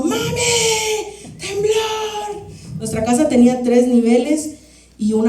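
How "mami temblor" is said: put-on high, frightened childlike cry; panicked cry for mom. Girl calling her mother during an earthquake, terrified cry. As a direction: scream for mom as the house shakes. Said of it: "y yo".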